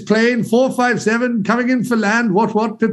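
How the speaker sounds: jaunty, overdone British airline voice; mock British accent. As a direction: imitate a bad attempt at a British accent. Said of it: "trying to do, like, the British accent".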